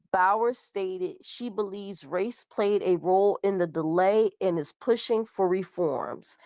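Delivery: normal talking voice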